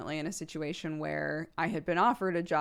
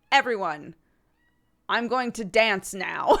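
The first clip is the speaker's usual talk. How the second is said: loud, grand stage announcement, laughing through it; theatrical announcement; 4 semitones higher, 8 dB louder than usual. Retold or imitated. Child in a dress announcing her dance, loud and laughing. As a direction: proclaim it like a hammy little performer. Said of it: "put on a dress and be like".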